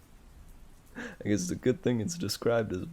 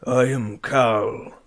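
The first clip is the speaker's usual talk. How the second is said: slow, loud dim grunt; 7 dB louder, slower than usual. A narrator's reading. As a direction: grunt it proudly like a brute. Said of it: "the ox grunted".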